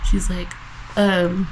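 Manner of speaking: normal talking voice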